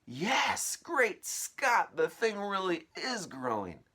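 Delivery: rising to a high, loud gasp; stutter, then explode in amazement; stammering disbelief to shock. Acted. Aunt disbelieving, then seeing it grow, high and loud.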